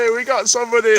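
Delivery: high, loud delighted cheer; excited laughing. Real, not acted. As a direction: cheer and laugh at the first viewer. First viewer joins stream.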